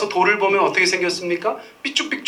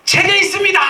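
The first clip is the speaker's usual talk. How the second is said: much higher, loud confident answer; cheeky loud blurt; 14 semitones higher, 5 dB louder than usual. Retold or imitated. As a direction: blurt it out proudly. Boy blurts answer to teacher. (선생님께 외치는 높고 큰 대답)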